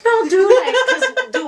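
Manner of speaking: high, loud laughing denial; real incredulous laughter breaking into the words. Real, not acted. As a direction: laugh through the answer. Laughs at being asked about pregnancy.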